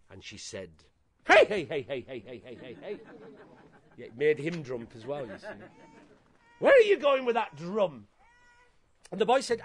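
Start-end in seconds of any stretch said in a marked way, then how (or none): 1.3-3.0 put-on old lady voice. Horrible auntie spots boy sneaking past, old lady cackle. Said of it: "my best old lady voice". 6.6-8.1 put-on high, loud challenge from a horrible old auntie; old lady voice. Horrible auntie catches boy with drum, loud old lady voice. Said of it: "my best old lady voice".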